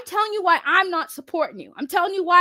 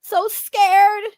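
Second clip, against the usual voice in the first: sarcastic scared; 4 semitones higher, 4 dB louder, much slower than usual. Pretend. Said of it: "hear the fucking scaredness in my voice".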